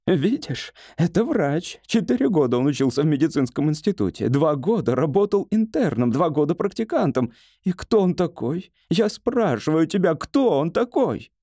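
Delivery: high-pitched, scornful grumbling to an ally; indignant hypochondriac. Acted. Patient complains to the wall about doctor, indignant. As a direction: complain with rising indignation, slowing on the last question.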